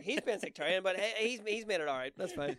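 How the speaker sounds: loud, high, falsely generous; mock kind excuse. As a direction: brush it off, overly nice. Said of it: "I was just gonna be like".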